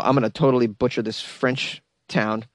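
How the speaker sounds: ordinary talk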